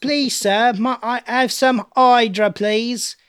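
high, pleading Cockney urchin; hammed-up plea for a morsel; begging British orphan. Answers in mock Oliver Twist voice.

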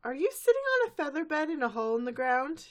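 high, puzzled teacher voice; Miss Cheerly voice. Retold or imitated. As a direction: ask it dazed and confused.

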